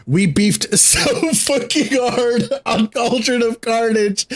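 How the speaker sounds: high and slow, laughing through it; amused laughing recollection. Real, not acted. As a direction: giggle the words out. Laughing while recalling raid banter.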